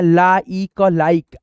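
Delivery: ला ई का लाइक piece by piece, very slow; sounded out. Shown as a demonstration. Teacher builds the word for students, ला ई का लाइक slowly. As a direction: spell the sounds ला ई का, then blend into लाइक. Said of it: "लाइक बोलते हैं".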